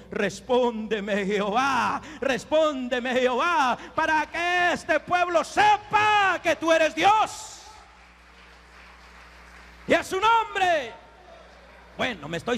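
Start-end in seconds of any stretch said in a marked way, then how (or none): 0.1-10.9 impassioned invocation. Acted. Preacher quotes Elías asking Jehová to answer, impassioned invocation. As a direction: cry out to God, swelling to full voice. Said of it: "Elías dijo".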